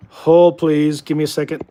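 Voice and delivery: loud call of hold please; mock hold call. Streamer stepping away tells chat to wait, loud mock hold call. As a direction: bark it out like a switchboard operator. Calling out before a bio break.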